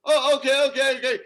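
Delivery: high, loud frightened okays; nervous okays. Retold or imitated. Customers backing away from the gunman, loud and frightened. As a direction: back off fast, terrified of the gun. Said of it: "We're going".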